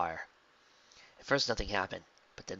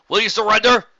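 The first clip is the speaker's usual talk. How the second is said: loud, high demand; stern soldier; 12 semitones higher, 16 dB louder than usual. A narrator's reading. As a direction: order her to give up.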